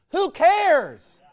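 high, loud dismissal; scornful shout. Real, not acted. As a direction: yell it out in contempt. Scornful retort to mimicked boast.

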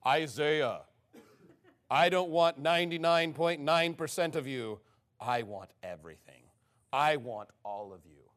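low, solemn mock voice of God; audible voice of God. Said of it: "I heard an audible voice".

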